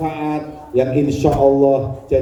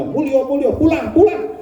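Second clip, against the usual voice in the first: much higher, shooing cry; shooing him out; 10 semitones higher than usual. Retold or imitated. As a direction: chase him out with a high shrill-pitched call. Woman drives him out.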